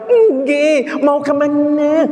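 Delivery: high, sweet polite greeting to the neighbour; polite wife. Acted. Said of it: "Aduh, sopan bisa". (istri sopan menyapa tetangga dengan suara tinggi manis)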